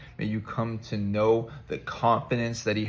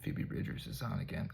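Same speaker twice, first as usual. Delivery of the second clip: normal talking voice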